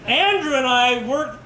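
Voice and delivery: usual speaking voice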